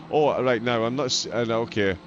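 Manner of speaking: ordinary talk, nothing marked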